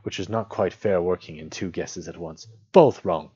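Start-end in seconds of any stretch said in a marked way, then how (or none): 2.7-3.3 loud, triumphant call; relieved cry. A narrator's reading. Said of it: "cried Bilbo, very much relieved".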